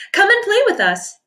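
high playful fish friends calling. Acted. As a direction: call out to a friend to play. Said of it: "they would call".

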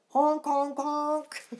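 honking like a car; voiced car horn honks. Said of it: "I can make a noise like a car".

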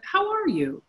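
caring question. Retold or imitated. Retold caring question from Byron.

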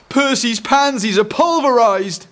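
high, loud cry of alarm; horrified outcry. Acted. Eric finds the neighbour's flowers wrecked, horrified outcry. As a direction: cry out in mock horror.

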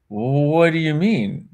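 drawn out, very slow what do you mean; slow puzzled question. Retold or imitated. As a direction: still not getting it, asking Gary again very slowly. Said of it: "And I was like".

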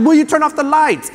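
high-pitched imitation of an upset kid; mock tearful yell. Seven-year-old stuck in the dark to dad, mock upset yell. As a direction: plead crossly like a scared little boy. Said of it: "I'm all mad, crying".

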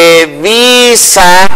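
"Televisa" drawn out very slowly, stress on "vi"; slow stress demo. Shown as a demonstration. Teacher writing on the board for viewers, slow drawn-out "Televisa" with stress on "vi". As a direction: stretch "Televisa" out, leaning on the "vi". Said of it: "deben pronunciarse con el acento en la penúltima".

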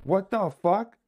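high shocked exclamation. Real, not acted. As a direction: blurt it out startled. Another scare.

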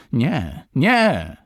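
high, loud desperate cry; woman crying out for her beloved's life; despairing scream. A narrator's reading. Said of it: "krzyczeć poczęła".

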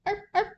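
high little yaps; short high arf sounds; imitated dog bark. A narrator's reading. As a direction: yap like an excited small dog. Dog barks at the party.

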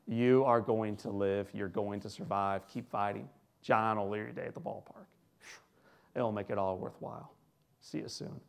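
low, soft reassurance; Jack Buck. Retold or imitated. Announcer back at the boy's bedside, tender and hushed. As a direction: quietly promise it, sinking to near-whisper by the end. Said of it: "walks in a second time and says".

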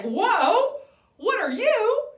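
high, loud astonished cry; a kid startled by a weird animal; amazed boy. Acted. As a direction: Jack gasping in wonder. Jack meets the chicken.

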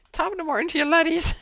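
put-on high Irish accent greeting; squeaky Irish YouTuber voice; Jacksepticeye impression. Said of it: "Imagine, as your tablet is dying".

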